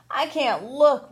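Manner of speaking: usual speaking voice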